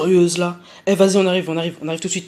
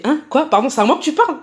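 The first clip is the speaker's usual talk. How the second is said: pardon lancé plus aigu et fort; choquée qu'on lui parle ainsi; outrée, incrédule; 8 semitones higher, 6 dB louder than usual. Retold or imitated. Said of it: "Je fais". (elle répond au type, indignée et plus aiguë)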